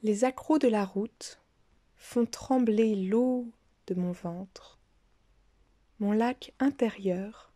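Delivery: measured poetic reading, long pauses between lines; poem recitation. Acted. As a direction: recite it line by line, letting each phrase settle. Recites own poems after talk. (lecture de poème, posée, entrecoupée de silences)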